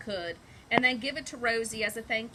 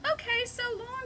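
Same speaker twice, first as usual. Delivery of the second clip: much higher cheerful goodbye; cheerfully; 13 semitones higher than usual. Acted. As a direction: chirp the farewell, much higher. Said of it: "said Rosie cheerfully".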